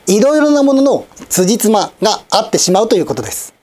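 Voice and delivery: ordinary talk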